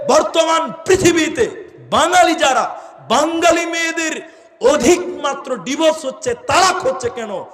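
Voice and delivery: high, loud preaching peak; full-force sermon cry; fiery preaching. Real, not acted. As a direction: build the outrage to a shout.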